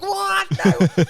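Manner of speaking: sudden high squeak of surprise; What? leaps up high in disbelief; shocked disbelief. Retold or imitated. Player hearing he'll debut, squeaking in shocked disbelief. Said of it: "And I was like".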